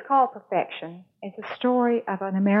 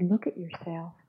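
gentle invitation; 7 dB quieter than usual. Acted. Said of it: "handed me a mirror and said".

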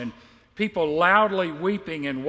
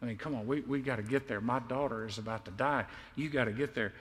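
under his breath; 6 semitones lower, 9 dB quieter, faster than usual. Retold or imitated. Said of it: "under his breath saying".

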